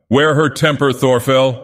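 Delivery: calling out. A narrator's reading. Bold young woman teasing Thorfell across the hall, calling out. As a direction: toss it over the din, bold. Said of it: "called a bold-eyed young Juno".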